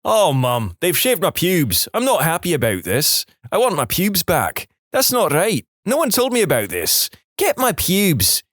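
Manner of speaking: high-pitched Scottish mock shouting; indignant, hammed-up Scottish wail, without real volume; broad Scottish accent. A narrator's reading. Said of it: "I'm shouting in my broad Scottish accent".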